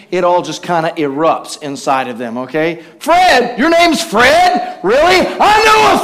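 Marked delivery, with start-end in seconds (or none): 3.0-6.0 high, loud, hammed-up indignant shout; mock outburst. Offended person recognizing a Fred in the room, hammed-up loud outburst. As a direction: play an offended person losing it over a name.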